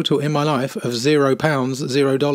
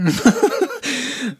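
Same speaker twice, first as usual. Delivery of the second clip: disbelieving laugh; 12 semitones higher than usual. Real, not acted. Commentator appalled by the expert's answer, disbelieving laugh. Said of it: "I'm laughing because it's so terrifying".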